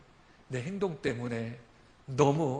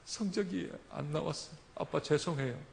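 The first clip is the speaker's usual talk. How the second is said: tearful daughter; 4 semitones higher than usual. Retold or imitated. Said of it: "닭똥 같은 눈물을 흘리면서".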